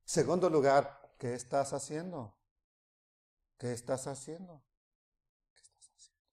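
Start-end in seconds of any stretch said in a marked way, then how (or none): 1.2-4.6 much softer, lower repeated question; fading gentle call. Shown as a demonstration. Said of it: "va hablando más despacio". 5.5-6.2 whispered, nearly gone; a last whisper of the question. Shown as a demonstration. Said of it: "va hablando más despacio".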